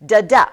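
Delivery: "Da-da" with a loud, punched second beat; iambic stress. Shown as a demonstration. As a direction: hit the second syllable of "Da-da" hard. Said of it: "Here's iambic".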